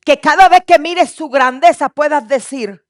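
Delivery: loud, rising sermon build; fervent preaching. Acted. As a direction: preach it with full fire. Preaching at full force.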